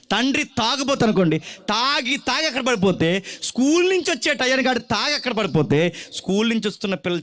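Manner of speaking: put-on preacher pressing the congregation on a lonely child; full-force preaching. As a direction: preach it out at the top of the voice.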